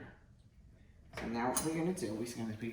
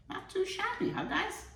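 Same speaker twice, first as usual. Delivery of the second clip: proud squeal. Real, not acted. Showing off finished ravioli.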